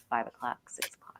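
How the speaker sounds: hushed, fading list; trailing to whisper. Real, not acted. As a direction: let the list fade into a whisper. Counting off hours, voice trailing away.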